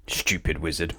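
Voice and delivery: low, soft grumbling mutter. Acted. Mister Mean grumbling after the wizard vanishes, soft mutter. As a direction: mutter it sourly under your breath. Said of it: "muttered Mister Mean".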